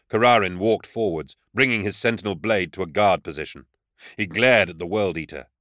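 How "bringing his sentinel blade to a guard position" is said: ordinary delivery, nothing marked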